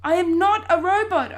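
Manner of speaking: high, very loud denial; shout. A narrator's reading. Boy denying to his family he's a robot, shouting. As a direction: shout it in protest. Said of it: "I shout".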